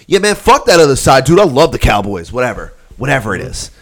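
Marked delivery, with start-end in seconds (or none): 0.1-2.3 loud, raised-pitch partisan boast; hammed-up team loyalty; acted rowdy fan. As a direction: play a fired-up bro trashing the rivals.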